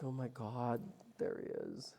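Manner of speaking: soft, gasping under the breath; hushed awe at spotting him; awed hush. Retold or imitated. Seeing the guru walk into the airport, breathless hush.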